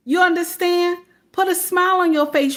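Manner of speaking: high, fast emphatic repeat; insistent high repeat. Real, not acted. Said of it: "repeats Kevin Samuels' advice emphatically".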